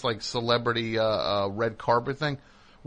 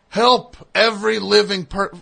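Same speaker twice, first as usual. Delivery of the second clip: louder, higher repeating; mock host insistence; 7 semitones higher, 8 dB louder than usual. [name] repeating the wrong slogan to the crowd, louder. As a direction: hammer the wrong slogan again and again. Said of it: "he's going over and over".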